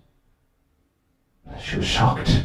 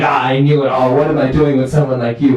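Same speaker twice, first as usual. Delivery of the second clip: high, exasperated outcry; acted marital yelling; 6 semitones higher, 3 dB louder than usual. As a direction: wail the complaint at your spouse, pitched up. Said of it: "They're yelling and they're yelling".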